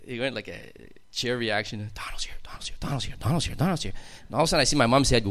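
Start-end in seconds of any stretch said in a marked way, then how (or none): 1.9-2.8 hushed whisper spreading through the pews. Retold or imitated. Churchgoers whispering as Donald walks in, hushed whisper.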